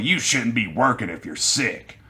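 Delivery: mock scolding, drawn out without raising the voice; pissed-off older woman. Older customer scolding a sick cashier, imitated pissed-off voice. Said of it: "The older woman gets really pissed off".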